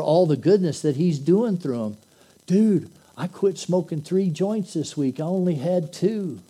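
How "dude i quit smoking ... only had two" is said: high, loud laid-back stoner voice; stoner dude. Acted. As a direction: brag like a chilled-out buddy. Two stoner buddies chatting.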